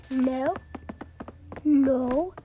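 loud, firm no; loud denial. Pretend. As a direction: shout the denial. Students deny loudly.

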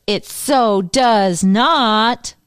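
mock outraged Ross, slow and emphatic; emphatic denial. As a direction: drag out every word in firm denial. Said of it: "he's like".

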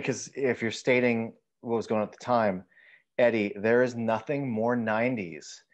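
normal talking voice

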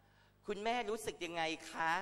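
high, slow sugary reporter voice; mock TV reporter asking a grieving mother. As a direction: ape a clueless reporter, sweet and drawn out. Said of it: "เขาก็ถามว่า".

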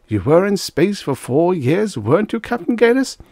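high, effusive question; showy public questioning. A narrator's reading. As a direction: prompt the hero for the audience. Mayor prompts hero before cameras.

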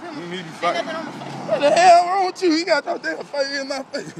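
laughing indignation; mock outrage. Real, not acted. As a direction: squeal it like you're fending them off. Fending off someone with laughs.

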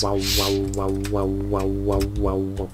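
low, pulsing wow-wow hum; vocal warbling on one low note; beam noise imitation. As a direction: make a droning low beam sound.

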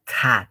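TA with a clear puff of air; blown T. Shown as a demonstration. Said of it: "Tipo soplando".

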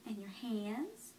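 put-on quiet, gentle questions to a baby; soft parent voice. Parent quietly quizzes the baby on body parts and objects. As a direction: ask softly, near a whisper.

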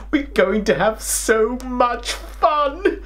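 plain reading voice

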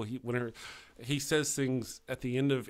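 usual speaking voice

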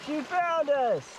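high, loud, drawn-out cry of delight; thrilled, slow, high-pitched exclaiming; excited reunion. Real, not acted. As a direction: call out overjoyed at a lost dog's return. Lost dog turns up.

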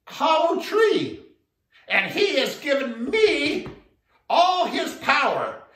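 high, loud, slow boast; bold little frog. Acted. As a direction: puff up like a tiny hero. Little frog bluffs to elephant.

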